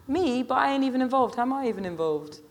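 put-on high-pitched, affronted teenage girl; protesting girl. A narrator's reading. Girl telling the Rasta she isn't involved, affronted. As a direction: protest your innocence in a high voice.

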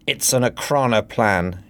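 plain reading voice

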